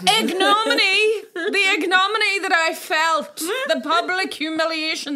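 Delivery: a hammed-up public figure mourning their humiliation; mock grand shame. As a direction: wail about the disgrace theatrically. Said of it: "like a politician".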